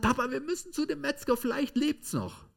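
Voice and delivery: put-on high, pleading child's voice; a small boy crying to his papa; boy runs to his father, voiced high and upset; distraught little boy. Boy begs father to visit butcher.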